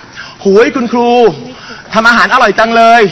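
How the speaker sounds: mock a market seller praising the teacher, stretched out; market vendor. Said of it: "ถามดิฉันน่ะนะคะ". (เลียนเสียงแม่ค้าชมครู)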